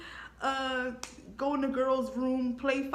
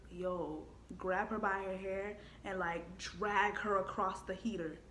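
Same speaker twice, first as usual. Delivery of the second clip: evil inner voice; 4 semitones lower, 8 dB quieter, slower than usual. Retold or imitated. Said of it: "the evil voice came in my head".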